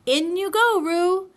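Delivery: loud, high call of the name; loud bright call to Roo. A narrator's reading. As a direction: sing out the order loudly. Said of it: "Rabbit said in a loud voice".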